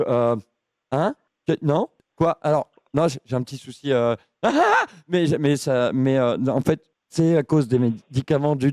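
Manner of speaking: low, halting, befuddled caller; dopey confused voice. Acted. Prank caller playing confused.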